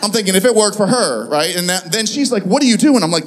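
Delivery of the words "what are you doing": louder, mock-startled question; retold startled demand. Wife questions praying husband, retold startled demand. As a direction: burst in with a baffled question.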